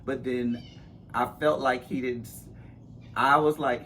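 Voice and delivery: ordinary talk, nothing marked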